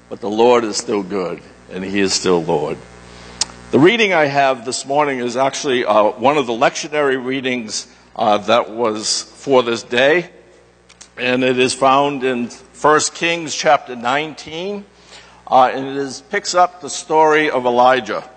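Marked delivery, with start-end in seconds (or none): none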